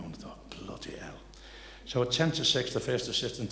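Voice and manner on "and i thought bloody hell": unvoiced muttered whisper; hushed exasperated whisper. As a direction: breathe it under your breath in dismay. Whispered aside in retold scene.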